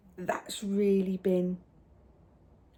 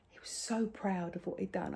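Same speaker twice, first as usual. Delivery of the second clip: whispering; 7 dB quieter than usual. Real, not acted. Tender hushed telling.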